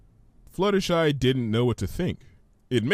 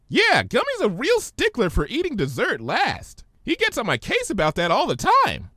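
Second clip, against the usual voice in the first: much higher, bubbly pony; Pinky's voice; 12 semitones higher than usual. Acted. Pinky puzzled by Twilight's big word, high voice.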